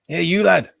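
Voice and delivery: put-on jokey half-hearted Scottish accent. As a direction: greet a mate in a lightly voiced Scottish brogue. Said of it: "Sorry, that's Scots".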